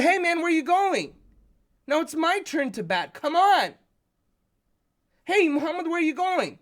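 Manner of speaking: high, loud shout after a brother; calling out. Retold or imitated. Boy calls after his brother walking away with the bat, high and loud. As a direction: yell across the yard.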